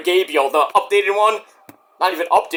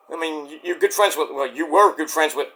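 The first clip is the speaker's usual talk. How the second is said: ordinary delivery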